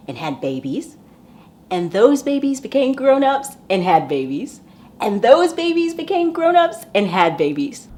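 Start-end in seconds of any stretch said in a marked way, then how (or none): 1.7-7.9 each repeat pitched higher and louder; storyteller winding up the listeners; building excitement. A narrator's reading. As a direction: climb higher with every repetition. Repeating line, rising each time.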